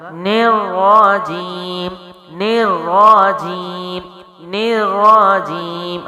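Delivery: نیر را جیم slow, heavy doubled ر, long ی; tajweed recitation. Shown as a demonstration. Teacher drilling the phrase, slow recitation. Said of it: "تو کیا ہوگا؟".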